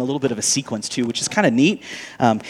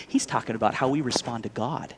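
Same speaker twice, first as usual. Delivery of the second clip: usual speaking voice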